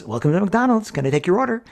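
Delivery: put-on pleasant young woman. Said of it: "a very pleasant young woman's voice comes across".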